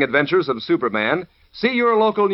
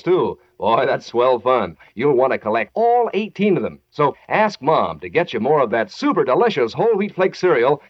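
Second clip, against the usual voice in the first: ordinary talk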